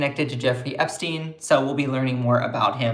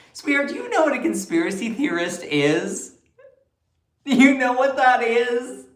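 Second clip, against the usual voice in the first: incredulous challenge; 9 semitones higher than usual. Real, not acted. Challenging a commenter's insult.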